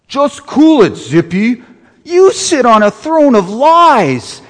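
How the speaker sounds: loud Brooklyn Santa; a hint of a Brooklyn Santa; Brooklyn accent. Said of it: "a Santa with kind of a Brooklyn accent".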